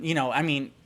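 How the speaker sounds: ordinary talk, nothing marked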